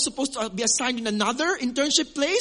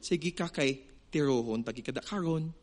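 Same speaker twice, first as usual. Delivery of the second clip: low, soft Cebuano threat said in a singsong; 6 semitones lower, 5 dB quieter than usual. Shown as a demonstration. Preacher showing how Cebuanos sound even when threatening. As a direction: sing the Cebuano threat gently, no anger. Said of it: "the tone is still like".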